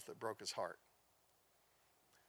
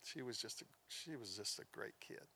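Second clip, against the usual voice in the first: hushed, much softer, heartbroken; grieving dad; 4 semitones lower, 12 dB quieter than usual. Retold or imitated. Grieving father telling the pastor, hushed and broken. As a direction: barely get it out, quietly crushed. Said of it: "He just said".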